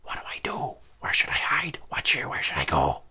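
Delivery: panicked whisper. Retold or imitated. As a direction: whisper it so the husband can't hear. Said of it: "real quiet".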